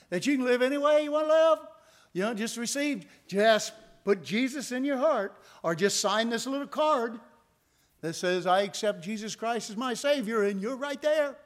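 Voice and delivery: high, loud mock preacher pitch; imitating other preachers. Pastor mimicking preachers who promise easy salvation, high mock preaching. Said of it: "there's preachers that will be preaching".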